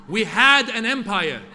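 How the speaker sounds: put-on loud, slow, proud line in a Persian accent. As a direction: declare it with pride, slowly, Persian accent. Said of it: "And my dad would say".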